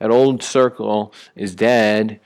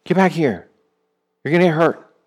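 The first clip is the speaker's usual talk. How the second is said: calling us back; 7 semitones higher than usual. Retold or imitated. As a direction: call a wandering child home. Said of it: "he calls us back".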